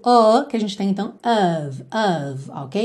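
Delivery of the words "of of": "of" as "uhv", neutral vowel. Shown as a demonstration. Teacher repeats for learners, neutral O and V sound. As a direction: give "of" a dull "uh" and a v. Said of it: "mistura do O com o som bobo".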